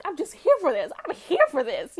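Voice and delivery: fully loving the scene; thrilled. Real, not acted. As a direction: hype it up like a fan. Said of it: "I'm like".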